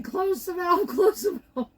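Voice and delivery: high-pitched mock scream; high, half-held-back yell, laughing through it; retold scream. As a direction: imitate a panicked scream without full volume. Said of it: "I'm like, screaming".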